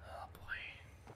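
very soft, voiceless whisper; whispered dismay. Real, not acted. Player sneaking near enemies, dismayed whisper. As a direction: whisper it so nobody notices.

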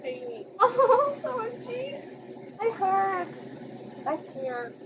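high, tearful wail sinking away; real near-crying sympathy; heartbroken. Real, not acted. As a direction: coo in pain for someone you pity. Heartbroken reaction to character's suffering.

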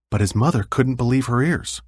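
ordinary delivery, nothing marked

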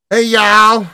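put-on Southern accent. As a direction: imitate the Southern-sounding cashier again. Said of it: "I know we're Midwest, but it's Chicago".

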